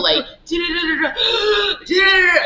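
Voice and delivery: high, loud gasps and da-da-da bursts; gasping preacher imitation. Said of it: "with the whole".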